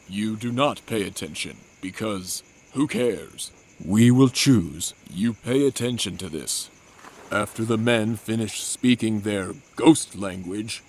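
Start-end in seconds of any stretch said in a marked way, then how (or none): 3.9-4.9 louder, slow announcer call; booming vote call; game show call. Acted. Narrator calls for group vote.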